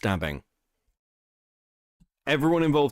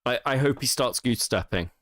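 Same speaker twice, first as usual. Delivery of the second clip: usual speaking voice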